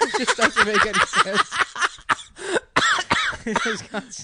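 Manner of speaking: high, loud words squeezed through laughter; helplessly cracking up while trying to talk; real laughing fit. Real, not acted. Comic fan retelling absurd cartoon to friends, laughing hard.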